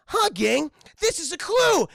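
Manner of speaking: high, loud, eager gang leader; Fred. Retold or imitated. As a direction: announce it like a cartoon hero. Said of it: "Fred's like".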